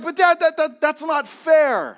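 put-on high, loud, slow childish complaint; son's high whine at his father; whiny kid protest. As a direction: stretch it out like a boy pleading unfairness. Son protesting in retold story.

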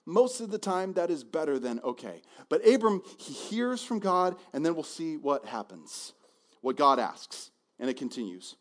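normal talking voice

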